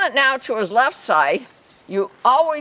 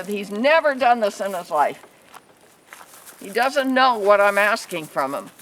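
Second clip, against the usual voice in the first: usual speaking voice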